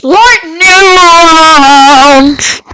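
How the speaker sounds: huge, long-drawn high shout; announcer yell. Pretend. Player hyping the rounds as announcer, drawn-out yell. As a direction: belt it out, stretching every vowel. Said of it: "I, I just love going like that".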